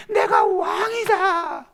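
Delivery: high, slow, pleading shout; desperate shout. Acted. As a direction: cry out that you're the king. Wounded king cries out.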